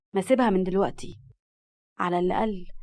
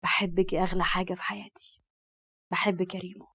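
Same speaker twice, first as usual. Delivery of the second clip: whisper; 3 semitones lower than usual. Acted. Murad whispering love to Rimas while dancing. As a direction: breathe it close to her ear while dancing. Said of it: "وهمس لها".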